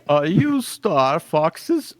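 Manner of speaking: slow, drawn-out cartoon coyote; slow cartoon villain introduction; Wile E. Coyote. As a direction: stretch the words like a self-important cartoon schemer. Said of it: "I'm gonna be like the Wi-, Wile E. Coyote voice".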